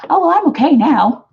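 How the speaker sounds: mocking a viewer who claims to be over it; mock breezy denial. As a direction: brush it off like it's nothing. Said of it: "that you're like".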